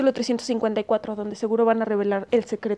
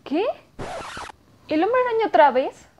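exasperated, louder and higher; annoyed surprise; 8 semitones higher, 4 dB louder than usual. Acted. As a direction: groan at the rerun again. Annoyed at TV rerun.

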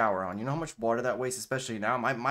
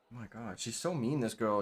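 normal talking voice

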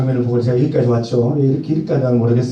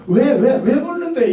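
much higher, exasperated whine; mock irritated, pitched way up; annoyed; 9 semitones higher, slower than usual. Husband irritated by wife asking again. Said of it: "딴 거 필요 없어요".

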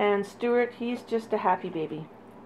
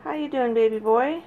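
normal talking voice

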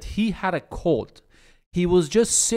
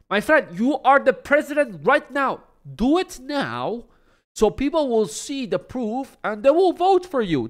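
exasperated outburst; 11 semitones higher, 5 dB louder than usual. Real, not acted. Exasperated rant at the president.

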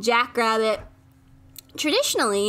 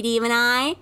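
ordinary talk, nothing marked

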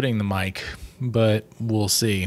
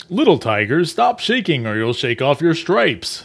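a hint of a gently teasing tiger dad; father teasing his son, lightly voiced; 4 semitones higher than usual. Tiger father teases his shaking son, a touch playful. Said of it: "said his father".

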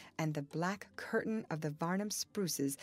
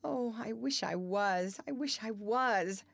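acted tearful wail, pitched up; mild sob in a raised voice; sobbing; 4 semitones higher than usual. A narrator's reading. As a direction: wish it aloud in anguish. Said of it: "she sobbed".

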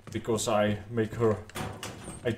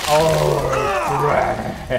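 laughing; 5 semitones higher, 6 dB louder than usual. Real, not acted. Grabbed by zombie.

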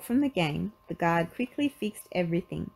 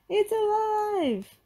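very high, drawn-out cry of alarm; alarmed, high-pitched, slow yell; boy's shout; 4 dB louder, much slower than usual. Acted. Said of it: "a boy shouted".

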